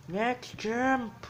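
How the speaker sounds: high, loud shout of next champ; excited announcement. Real, not acted. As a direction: call it out like a game show host.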